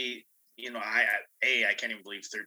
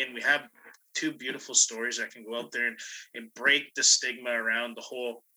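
ordinary delivery

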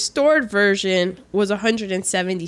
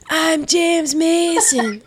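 slow, higher impression of the actor; James Mason; 4 semitones higher, slower than usual. Cracking up at the movie, doing a James Mason impression. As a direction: stretch it out as a stylised film-star voice. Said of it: "I'm cracking up 'cause".

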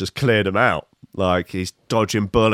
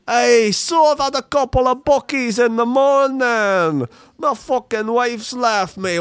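mock Scottish rant, pitched up high; bad Scottish accent; 11 semitones higher than usual. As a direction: rant like a drunk Scot in a terrible put-on accent. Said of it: "That accent is so bad".

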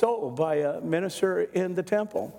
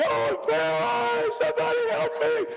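panicked plea. Retold or imitated. Boy in bed wailing to family for help, panicked. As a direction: beg for help like you can't move. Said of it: "I'm like".

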